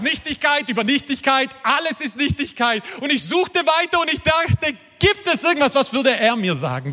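high-pitched, urgent outcry; driven, exclaiming desperation; impassioned despair. Acted. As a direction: preach it high, as a desperate searcher. Preacher voicing Solomon's desperate search.

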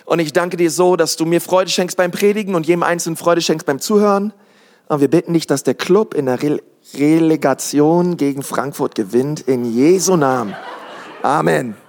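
low-pitched spoken prayer; opening prayer. Real, not acted. Preacher asks God to bless sermon and club, low prayer. Prays aloud before preaching.

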